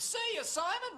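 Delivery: high-pitched imitated yell; imitated high scream; mock scream. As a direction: squeal out the farewell, imitating a scream. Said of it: "Oh, you screamed".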